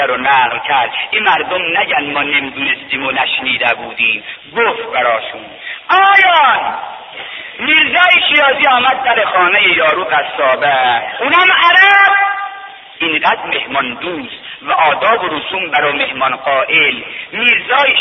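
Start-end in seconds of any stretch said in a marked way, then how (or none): 5.9-12.1 high, loud chanted preaching; soaring rowzeh-style sermon chant; preaching at full force. Acted. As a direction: lift the journey into a ringing chant.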